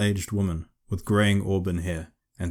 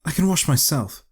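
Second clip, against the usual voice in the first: higher, sharp defiant hiss; 8 semitones higher, 4 dB louder than usual. A narrator's reading. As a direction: spit it out, bristling. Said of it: "Nepeta hissed".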